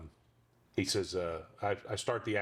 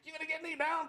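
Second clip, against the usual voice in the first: high deadpan horse puppet. Acted. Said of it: "his voice is really close to my normal voice".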